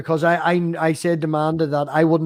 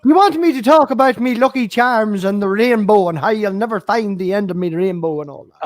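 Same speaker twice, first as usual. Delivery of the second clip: higher, louder leprechaun brogue; stage Irish accent; 5 semitones higher, 3 dB louder than usual. Acted. Mocking Irish stereotype on request.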